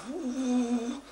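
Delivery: long, high "woo" fading into the distance; a sustained high engine whoop; imitated jet ski whoosh. Snorkeler towed away by the jet ski, high whoosh.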